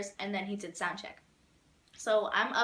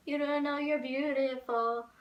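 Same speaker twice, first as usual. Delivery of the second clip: put-on slow, higher crooning boyfriend voice; imitating Jacob; 4 semitones higher than usual. Fan imitating Jacob crooning a compliment, slow and higher.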